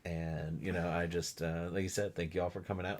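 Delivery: low, soft, casual imitation; understated, easygoing talk to a crowd; [name], natural. Imitating a groom thanking guests for coming, natural and low-key. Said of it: "[name] seems natural".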